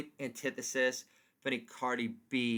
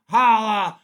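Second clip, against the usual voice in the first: loud holla; 5 semitones higher, 13 dB louder than usual. Acted. Catchphrase shout.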